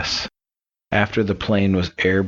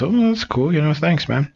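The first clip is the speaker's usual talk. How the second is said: higher-pitched, casual thanks; a hint of easygoing boys, pitched up; lightly voiced grateful boys; 9 semitones higher than usual. Three boys thank the devil.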